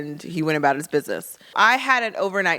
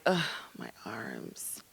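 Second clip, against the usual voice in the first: groggy groan; 9 semitones lower, 15 dB quieter, much slower than usual. Retold or imitated. Groggy patient waking after surgery.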